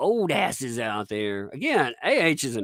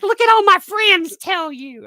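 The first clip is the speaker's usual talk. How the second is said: high, loud mock bragging; shouty high-pitched impersonation; mocking detractors; 10 dB louder than usual. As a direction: imitate a boastful critic up high. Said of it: "They're like".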